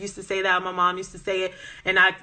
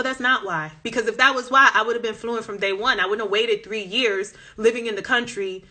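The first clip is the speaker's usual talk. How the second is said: normal talking voice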